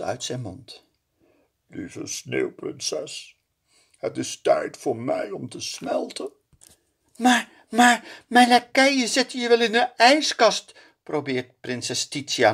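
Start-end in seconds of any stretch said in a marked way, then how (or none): 1.7-6.3 slow, whispered farewell, much softer; dying snowman says goodbye. A narrator's reading. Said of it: "Sneeuwman begint zacht te praten". 7.2-10.7 high, louder desperate plea; pleading. A narrator's reading.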